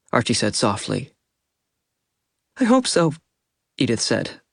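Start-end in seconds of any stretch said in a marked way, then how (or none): 2.6-3.1 higher, voice cracking with grief; breaking voice, near tears. A narrator's reading. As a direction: let the words break. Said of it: "her voice breaking".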